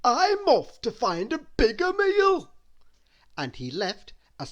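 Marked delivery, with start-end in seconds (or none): 0.0-2.5 high, greedy character voice; high comic greedy voice; Mister Greedy voice. A narrator's reading. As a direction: play the greedy little man, pitched up. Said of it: "he said".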